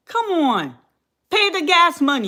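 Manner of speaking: pushing it up in disbelief; exasperated. Real, not acted. To her live viewers, rising in exasperated disbelief.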